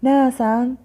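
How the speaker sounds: ناسا read with a final -an; tanween fath. Shown as a demonstration. Teacher reading out the word list, tanween fath on ناسا. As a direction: sound out ناسا as "naasan". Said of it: "تنوين فتح".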